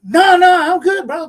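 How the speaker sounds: high, loud retold brush-off; emphatic reassurance. Telling the apologizing stranger not to worry, emphatic reassurance. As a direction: imitate insisting that all is fine.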